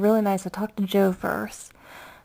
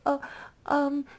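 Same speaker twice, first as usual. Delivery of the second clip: higher, timid asking; nervous fan asking a star to pass the phone; 7 semitones higher than usual. Retold or imitated. A fan nervously asking Joe to talk to Craig, timid and higher. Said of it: "I was so nervous".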